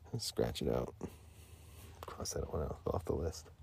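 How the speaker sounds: unvoiced whispered aside. Real, not acted. Streamer crossing an idea off, whispering. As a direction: whisper it to yourself.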